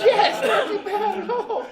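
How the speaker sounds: high, loud laughing reply; laughing agreement. Retold or imitated. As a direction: giggle out the girlfriend's line. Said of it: "She goes".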